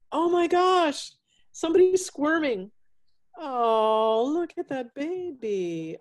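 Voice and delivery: high, loud, slow delighted coo; excited cooing. Real, not acted. Host on a video call cooing at a squirming pet, delighted and loud. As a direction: gush over the squirming pet.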